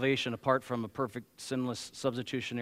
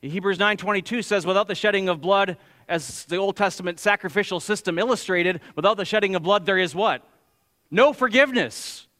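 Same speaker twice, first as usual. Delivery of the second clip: raised, louder pulpit voice; forceful preaching; 5 semitones higher, 7 dB louder than usual. Acted. Preacher to congregation on why blood must be shed, forceful preaching.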